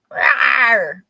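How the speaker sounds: loud playful dinosaur rawr; dinosaur roar. Pretend. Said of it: "I don't wanna be a dinosaur".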